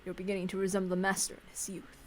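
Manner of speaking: put-on measured elderly head servant greeting; composed old butler voice; calm composed old servant.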